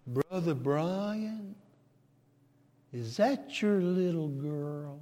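put-on slow, drawn-out, soft old preacher; lightly voiced elderly preacher, unhurried; a hint of the old preacher, very slow and soft; old preacher's unusual way of speaking. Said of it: "That's the way he talked".

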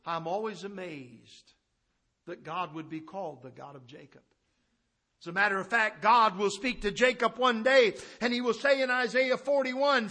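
normal talking voice

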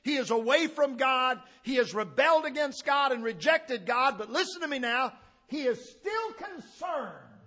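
loud, high preaching at full force; fiery preaching. Acted. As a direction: thunder it from the pulpit, high and loud. Preaching Saul's plea at full force.

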